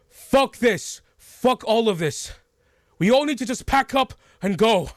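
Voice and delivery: high, loud angry outburst; panicked shout. Acted. Traveler yelling at the group to pack up, loud. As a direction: yell at everyone to flee. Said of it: "he just goes".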